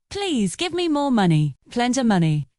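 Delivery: usual speaking voice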